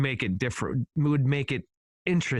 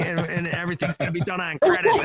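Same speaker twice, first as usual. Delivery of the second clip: alien voice; 5 semitones higher, 5 dB louder, faster than usual. Retold or imitated. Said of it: "Is that your alien voice?".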